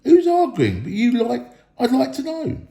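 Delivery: high, loud indignant whine; indignant troll. A narrator's reading. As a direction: protest your innocence hotly. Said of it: "said Tom".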